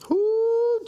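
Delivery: a sudden high "Ooh,". Real, not acted. Preacher reacting to a woman scorned with a high squeal.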